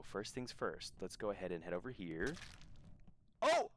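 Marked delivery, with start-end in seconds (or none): 3.4-3.7 high-pitched startled panic; panicked surprise. Real, not acted. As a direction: yelp it out in alarm. Misclick panic while streaming.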